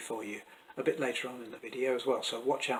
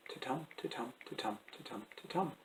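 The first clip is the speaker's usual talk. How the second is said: soft, evenly spaced "titum", stress on "TUM"; hushed "ti-TUM" beat counted out; iambic rhythm; 12 dB quieter than usual. Shown as a demonstration. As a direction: tap out "titum" quietly as five weak-strong pairs. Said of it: "you can hear the rhythm".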